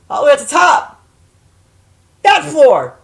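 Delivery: high, loud burst; emphatic exclaiming. Real, not acted. Woman searching for the word penthouse, loud exclaiming. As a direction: call out each phrase emphatically.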